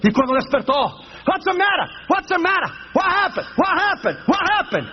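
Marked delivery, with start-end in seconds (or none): 1.3-4.9 high-pitched startled questions on waking; acted panic. Proud passenger waking as the plane drops, mock panicked. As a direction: act out waking in alarm, firing off questions. Man wakes in falling plane.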